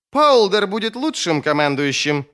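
loud, high insistent cry; shouting. A narrator's reading. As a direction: shout it across at a rival. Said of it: "кричал Сульт Моровии".

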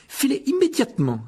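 high-pitched scolding order; outraged cry. Acted. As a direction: cry out an indignant dismissal. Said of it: "s'écria Percy". (ordre crié, aigu et indigné)

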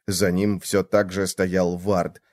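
ordinary delivery, nothing marked

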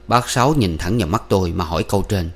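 usual speaking voice

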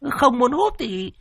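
very high, preening female voice; Cúc bragging to her husband, smug; smug woman. Acted. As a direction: boast proudly, nose in the air. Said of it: "phỏng mũi".

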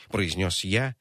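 ordinary delivery

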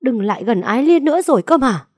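fast, high, loud order to get out; furious shout. Acted. Enraged father orders the young man away, shouting. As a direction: throw him out with a yell. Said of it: "như phát điên".

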